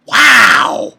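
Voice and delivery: high, very loud amazed wow; shouted wow. Real, not acted. Reviewer blown away by the moment, loud amazed shout. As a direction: burst out with amazement. Awed reaction, repeated bigger.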